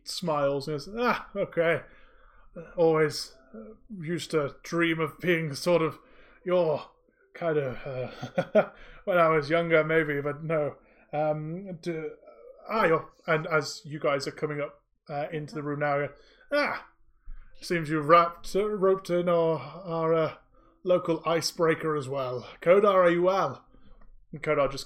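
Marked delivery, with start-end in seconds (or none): 0.9-13.1 put-on louder, laughing old speaker reminiscing; a cheerful elder with a chuckle; chatty old man. 16.5-23.6 put-on louder, laughing old speaker reminiscing; a cheerful elder with a chuckle; chatty old man. Old speaker greets newcomers.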